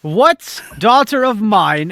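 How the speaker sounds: loud, slow, drawn-out scold; mock stern father. Father demanding which daughter did this, slow loud scold. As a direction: draw out a father's indignant demand.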